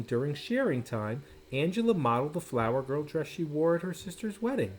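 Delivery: ordinary delivery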